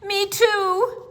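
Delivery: put-on loud high shout of a little girl. A narrator's reading. Storyteller voicing Lucille's shout at her father. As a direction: shout it like a left-out child. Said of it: "shouted Lucille".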